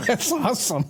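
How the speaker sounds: high-pitched, through real laughter; laughing. Real, not acted. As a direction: say it while cracking up at a joke. Laughing at the ticker joke.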